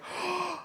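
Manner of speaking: sharp mock-startled intake of breath; mock gasp. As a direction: gasp as if suddenly spotting it. Imitating characters finally seeing it.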